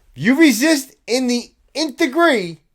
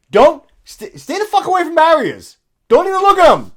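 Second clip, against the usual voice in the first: higher, louder angry rant; loud scolding; 8 semitones higher, 6 dB louder than usual. Real, not acted. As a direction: scold him hard and loud. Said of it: "heated lecture to protester".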